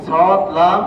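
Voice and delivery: loud, clear সদ, লাম for the class to echo; drilling letter names. Shown as a demonstration. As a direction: call out সদ, লাম for repetition. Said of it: "teacher drills letters loudly". (ক্লাসকে জোরে সদ, লাম বলা)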